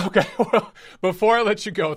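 laughing. Real, not acted. Host laughing.

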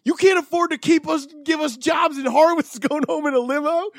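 high, angry group yell; mock outrage from laid-off workers; furious coworkers. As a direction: shout them down in outrage. Everyone was fucking furious.

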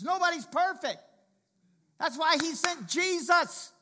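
fiery sermon peak; full-force preaching. Real, not acted. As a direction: build to a shouting crescendo for the congregation.

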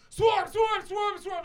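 very high, loud repeated yell; hammed-up raid alarm; mock commando shout. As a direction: shout it like security storming in. Mimics security storming the venue.

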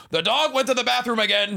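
high loud stepdad yell; pull string toy. Acted. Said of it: "thirteen different sayings".

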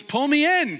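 high loud desperate plea; panicked demand. Retold or imitated. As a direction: beg to be hauled in. Panicked man in water.

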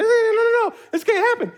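panicking inside about missing the flight; mock panic. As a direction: refuse it in a rising panic.

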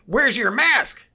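high, loud, drawn-out mock yell; mock outraged shout. Older shopper confronting a maskless man, mock outraged shout. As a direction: imitate a self-righteous man shrieking his demand.

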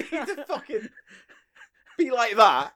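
talking through laughter; laughing. Real, not acted.

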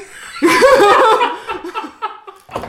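loud, high burst of laughter; real laughing outburst. Real, not acted. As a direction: break into a laugh. Laughing at the whiny tattletale.

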